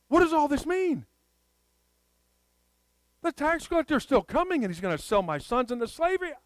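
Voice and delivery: high, loud, mock-frantic widow; acted panic. Widow realizing the tax collector is still coming, acted panic.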